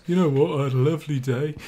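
mock posh British. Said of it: "making fun of British culture".

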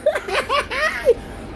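high-pitched drawn-out laugh; high cackle. Real, not acted. Gloating after cussing them out, high cackle. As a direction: burst into a high, gleeful cackle.

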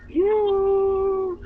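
high, loud playful yell; mock shout. Teasing Pokwang, shouting playfully. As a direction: yell it at your friend in fun.

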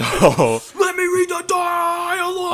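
very high, slow, loud mock demand; jokingly demanding to read the game dialogue; playful shout. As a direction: shout it like a ham actor.

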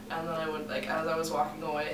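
ordinary delivery

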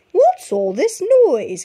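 high, slow clucking hen voice; fussy hen asks the chicks, clucking. A narrator's reading. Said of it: "clucked Henny Penny".

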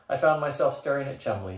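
ordinary delivery, nothing marked

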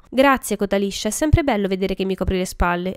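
plain reading voice

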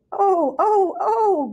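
high, loud, wailing oh oh oh; groaning. A narrator's reading. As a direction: groan in dismay as if dizzy. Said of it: "groaned the other sheep".

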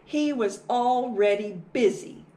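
usual speaking voice

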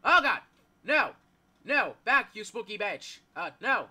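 startled, loud, high yells; panicked yelling. Real, not acted. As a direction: yell in alarm at a monster. Startled by the game's monster.

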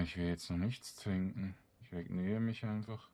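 ordinary talk

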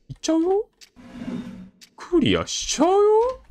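put-on high childish teasing voice; cutesy taunt. Player taunting the game, high cutesy child voice. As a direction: tease the game like a bratty kid.

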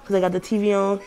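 muffled behind surgical mask; speaking through covered mouth; through a face mask. Real, not acted.

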